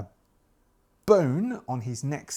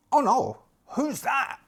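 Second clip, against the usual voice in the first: higher, mock-startled cry; alarmed cultists; 12 semitones higher than usual. Cultists at the altar hearing police above, mock alarm. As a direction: jerk your head up in a fright.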